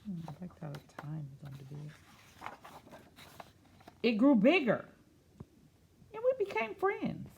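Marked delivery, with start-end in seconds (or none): 4.0-7.3 slow storybook read-aloud; picture book voice; reading to children. Acted. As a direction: read it gently to a class.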